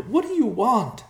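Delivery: high, desperate plea; anguished cry. Acted. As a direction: plead high and loud. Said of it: "she cried".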